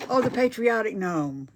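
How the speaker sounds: loud, bright-eyed burst of delight over the gnome; light up at the sight of it; delighted exclamation. Real, not acted. Crafter reacting to a viewer's patriotic gnome, loud delight. Spots a favourite gnome comment.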